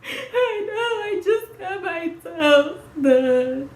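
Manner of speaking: high, slow, half-sobbing reply; laugh-crying. Real, not acted. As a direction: wail it through a crying laugh. Laugh-crying over burned feet.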